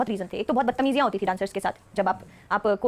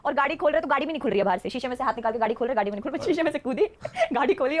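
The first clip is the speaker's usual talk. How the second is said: normal talking voice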